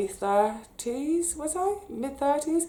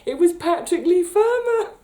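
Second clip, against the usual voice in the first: high, loud, voice welling up with feeling; reliving meeting her hero, choked with excitement; overcome with emotion; 10 semitones higher, 5 dB louder than usual. Real, not acted. Tells viewers her hero was the old man, overwhelmed and emotional. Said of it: "I'm gonna get all emotional".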